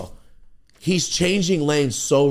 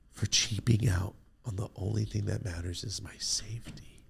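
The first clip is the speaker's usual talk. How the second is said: whispered self-reproach; 7 dB quieter than usual. Retold or imitated. Muttering thoughts in backseat.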